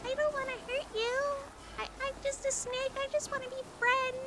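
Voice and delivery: very high, meek little cartoon snake. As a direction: squeak it out like a harmless tiny snake pleading. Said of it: "that's what he sounds like".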